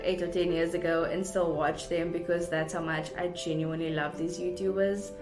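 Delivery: usual speaking voice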